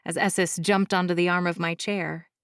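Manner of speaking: ordinary delivery, nothing marked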